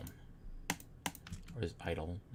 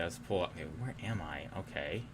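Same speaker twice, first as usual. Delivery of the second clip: ordinary talk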